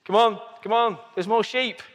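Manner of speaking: urging. Real, not acted. Urging people to take sheep.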